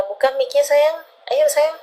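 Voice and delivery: high, coaxing call to a crying friend; sweet high coaxing, come on dear; coaxing a crying client to open the mic, high and sweet; coaxing sweetly. Real, not acted.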